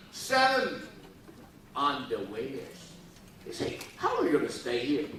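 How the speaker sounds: ordinary delivery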